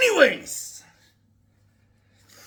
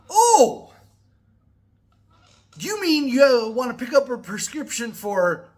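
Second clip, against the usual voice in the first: imitating the pharmacy clerk; 4 semitones higher, 5 dB louder than usual. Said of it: "She's like".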